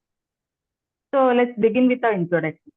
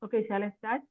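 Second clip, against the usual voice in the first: barely audible; 11 dB quieter than usual. Real, not acted. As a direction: ask too softly to be heard. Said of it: "please raise your voice".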